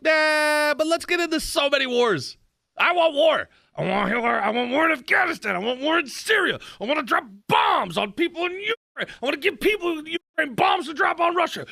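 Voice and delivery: high, hammed-up eager warmonger voice, building to a shout; mock them as gleeful war lovers. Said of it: "they were like".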